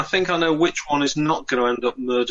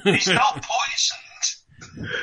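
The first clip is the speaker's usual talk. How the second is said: laughing through the words; chuckling while talking; 6 semitones higher, much slower than usual. Real, not acted. Joking about the king's health.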